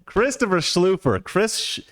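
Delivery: slow, high, drawn-out name announcement; mock grand name call. Real, not acted. Reading out his buddy's super chat name, slowly and grandly. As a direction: savour the name like a game-show host.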